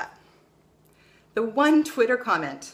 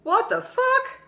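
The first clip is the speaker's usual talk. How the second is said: shot up high and loud in disbelief; stunned outburst; 4 dB louder, slower than usual. Retold or imitated. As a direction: blurt it out, totally baffled. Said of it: "you're sitting there going".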